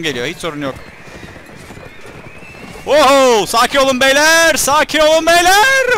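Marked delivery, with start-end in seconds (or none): 2.9-3.5 a loud, high battle whoop; excited whoop. Real, not acted. Gamer whoops as the battle starts, excited. Battle charge shout in game. 3.5-4.6 loud, high shout at the troops; shouted call. Pretend. Gamer shouts at his soldiers to calm down, loud. 4.6-6.0 slow, much higher, loud hammed-up cry; drawn-out mock shout. Gamer hams up calming his soldiers, slow high shout.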